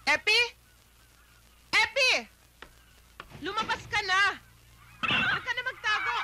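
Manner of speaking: high, carrying call into the house; wife shouting for her husband to come out. Acted. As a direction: call him out of hiding. Wife calls out for hidden husband.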